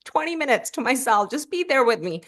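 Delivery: high, bright insistence that everything is fine; chirpy I'm-fine voice covering distress; forced reassurance. Retold or imitated. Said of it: "And I'm like".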